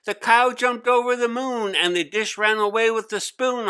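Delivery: put-on boy shouting a nursery rhyme while leaping desks; shouted rhyme. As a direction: bellow the nursery rhyme wildly. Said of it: "Hari shouted".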